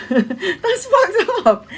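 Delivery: high laughing disbelief, slowed. Real, not acted. Laughing about people buying toilet paper. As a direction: crack up at the absurdity.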